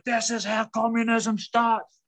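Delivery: high, slow, drawn-out proclamation; retold yelling, stretched words; mock yell. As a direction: cry it out like an alarmed mum. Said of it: "And my mum's yelling".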